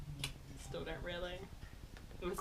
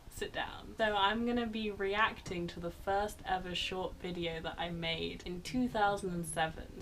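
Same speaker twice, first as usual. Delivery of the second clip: ordinary delivery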